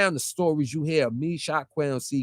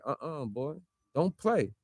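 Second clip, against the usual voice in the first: quoted warning; 2 semitones lower, 9 dB quieter than usual. Retold or imitated. Quotes onlookers warning him off.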